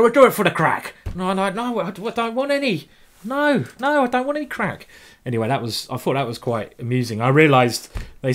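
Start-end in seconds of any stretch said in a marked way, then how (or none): 0.0-0.9 loud, high mock-Irish insistence; hammed-up Irish accent, pitched right up. Irish friends insisting to him, hammed-up Irish accent. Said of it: "And they're like". 1.1-4.9 high-pitched, hammed-up protest; mock panic. Him refusing his friends' offer of crack, mock-panicked squeal.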